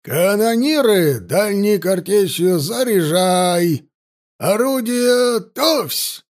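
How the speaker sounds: high, drawn-out shouted commands; Кунгурцев. A narrator's reading. Ship's captain orders gunners to fire a parting salute, shouting. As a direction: stretch each order, building to a final yell. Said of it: "рявкнул команду капитан Кунгурцев".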